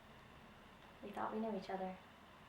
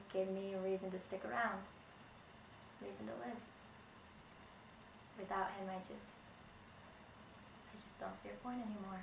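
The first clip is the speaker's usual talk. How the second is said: sad; 3 semitones lower, 16 dB quieter, faster than usual. Acted. Grieving widow losing hope.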